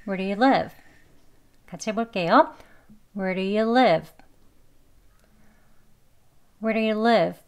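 "Where do you live" with tapped d, words joined; reduced "do you" before a clear "live"; conversational linking. Shown as a demonstration. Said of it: "대화 발음으로요".

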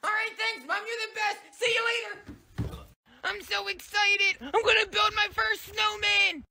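bubbling over about a first snowman; excited little boy. Acted. Excited kid.

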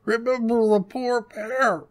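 put-on very high, muffled old-wife voice; high mumble round a huge mouthful; mouth stuffed full. A narrator's reading. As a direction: barely get words out through food. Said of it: "with her mouth so full that she could hardly speak".

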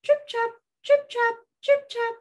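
tiny high-pitched trip-trap; high, dainty hoof sounds; small hooves. Acted. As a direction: tap out little hoofbeats in a high voice. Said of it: "the little billy goat had small hooves".

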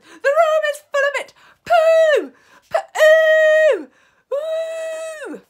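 very high, piercing disgusted shrieks; shouted disgust. A narrator's reading. Assembly of witches reacting to the stink, shouting. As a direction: shriek it, stretching out each pooh. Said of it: "they shouted".

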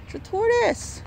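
normal talking voice

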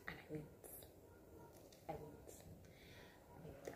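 whispered guilty admission; sheepish whisper. Real, not acted. Admitting she went to the pool, guilty whisper. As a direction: breathe it out like a confession. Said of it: "confessing disobedience".

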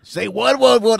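loud, high movie pimp impression; high mock yell; Pinky. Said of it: "just like Pinky from Next Friday".

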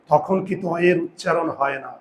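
ordinary delivery

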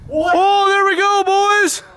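ordinary talk, nothing marked

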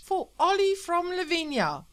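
high, loud radio announcer; DJ crying out. Acted. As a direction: shout the dedication over the noise. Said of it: "All Night Sam cried back at him".

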